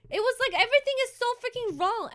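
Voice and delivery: real exasperated rant. Real, not acted. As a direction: let the frustration spill out fast. Ranting about the movie.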